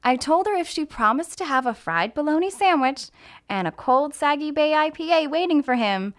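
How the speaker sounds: plain reading voice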